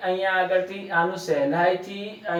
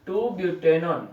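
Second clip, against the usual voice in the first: drawn-out naming; much slower than usual. Shown as a demonstration. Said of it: "teacher names compound while writing".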